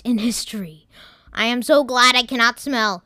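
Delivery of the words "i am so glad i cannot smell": computer voice. Acted. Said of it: "said the computer".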